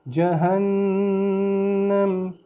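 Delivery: जहन्नम with a long nasal hum on the nun; nasal ghunna. Shown as a demonstration. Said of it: "इस पे गुन्ना करेंगे".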